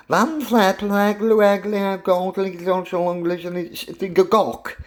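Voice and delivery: high, garbled stab at a long Welsh name; mangled mock-Welsh tongue-twister, starting high and easing down; botched Welsh pronunciation. Shown as a demonstration. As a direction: bluff through an unpronounceable Welsh town name. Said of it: "can't speak the Welsh language".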